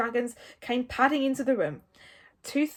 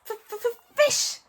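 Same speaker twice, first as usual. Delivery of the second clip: high, stuttering little dragon; squeaky creature demand; hissing Toothless; 13 semitones higher, 5 dB louder than usual. A narrator's reading. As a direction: stutter on the F, then burst out the word. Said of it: "hissed Toothless".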